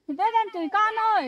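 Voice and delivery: calling out. Acted. Maid tattling to mother.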